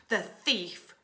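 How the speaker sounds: ordinary delivery, nothing marked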